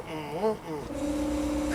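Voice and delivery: drawn-out vocal motor noise; imitated engine rev. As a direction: make the bike sound with your voice. Imitating the springer bouncing.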